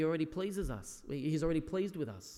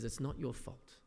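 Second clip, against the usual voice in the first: softer, tender retelling of the therapist; gentle reassurance; 2 semitones lower, 7 dB quieter than usual. Retold or imitated. Therapist gently telling Will it isn't his fault, softly tender. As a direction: coax him gently, like a father.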